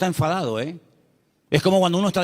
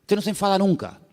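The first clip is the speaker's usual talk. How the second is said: mocking gossip; 3 semitones higher than usual. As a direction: lightly sneer at the calm man.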